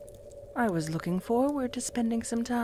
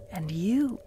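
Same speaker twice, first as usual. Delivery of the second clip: whisper; 2 semitones lower than usual. Acted.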